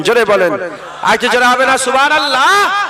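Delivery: loud rousing call to the crowd. Acted. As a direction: demand a bigger response. Urges crowd to chant louder. (শ্রোতাদের জোরে ডাক)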